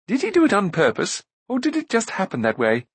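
high-pitched inquisitive prince; curious. A narrator's reading. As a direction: ask with innocent curiosity. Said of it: "studied the monster with great curiosity".

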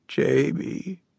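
high, hoarse croak of a dying woman. A narrator's reading. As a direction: rasp the name like a jackdaw. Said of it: "Her voice was as hoarse as a jackdaw's".

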